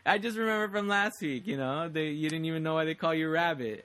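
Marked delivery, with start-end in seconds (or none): none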